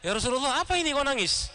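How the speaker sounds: high-pitched acted challenge; imitated surprised query; immediate questioning. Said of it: "lalu langsung".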